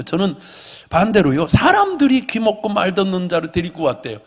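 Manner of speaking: loud, rising, fervent sermon climax; full-force preaching. Acted. Pastor proclaims a blessing over the church, loud and fervent. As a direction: lift the room with a loud blessing.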